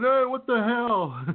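high, stretched-out yell at moderate volume; drawn-out, high-pitched retold holler; mock yell. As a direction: imitate a startled guy calling out. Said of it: "Some guy yelling".

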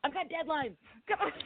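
high exasperated snap; high impatient leave-me-alone; stressed snapping. Retold or imitated. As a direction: brush everyone off, overworked. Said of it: "I was like".

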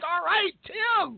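crowd cheering. Retold or imitated. Shoppers clapping for Tim, a stretched high mock cheer. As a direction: cheer him on like the clapping moms. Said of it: "everybody starts clapping, like".

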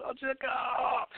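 high, breathy fake scream of fright; hammed-up terrified gasp; mock frightened cry. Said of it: "and I go".

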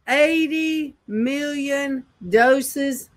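high, slow, drawn out in amazement; stunned disbelief. Real, not acted. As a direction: let each word sink in, astonished. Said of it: "What the world?".

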